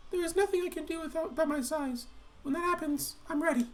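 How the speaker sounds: a timid giant speaking up high; high Mordecai voice. Pretend. Player voicing shy Mordecai in a high timid voice.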